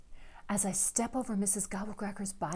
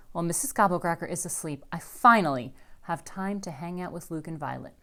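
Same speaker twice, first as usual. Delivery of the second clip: ordinary delivery, nothing marked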